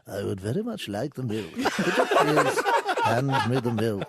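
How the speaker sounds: Alec Guinness voice. Retold or imitated. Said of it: "with Alec Guinness".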